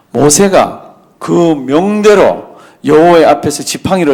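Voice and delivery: 천천히 또박또박 성경을 읽는 목소리; 말씀을 한 자 한 자 힘주어 낭독하듯. Acted. Reads verse 9. (느리고 엄숙한 성경 낭독)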